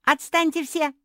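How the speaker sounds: very loud, high outburst; angry yell. A narrator's reading. Sister fed up with all the advice, yelling angrily. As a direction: shout everyone away from you. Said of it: "закричала Жози".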